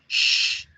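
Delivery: SH as a long hushing hiss; sonido del silencio. Shown as a demonstration. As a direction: say SH like shushing someone. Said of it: "el sonido del silencio". (SH como pidiendo silencio)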